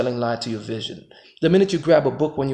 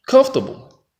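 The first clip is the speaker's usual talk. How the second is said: mocking; 11 semitones higher, 5 dB louder than usual. Real, not acted. Mocking the comfortable life.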